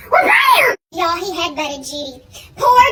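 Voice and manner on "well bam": high shouted bam; mock kid battle cry; kid's yell. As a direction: yell it like a charging toddler.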